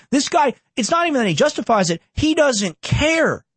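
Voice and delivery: high, indignant repeat of He doesn't care; rising disgust hammered twice; outraged. Real, not acted. Ranting to viewers about the lawyer, high and outraged.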